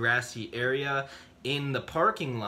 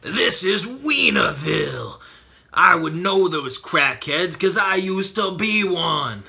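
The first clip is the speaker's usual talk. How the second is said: loud, raised voice of a threatening man; voiced angry local; 5 semitones higher, 6 dB louder than usual. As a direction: bellow it as the man with the pipe. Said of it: "This guy is like".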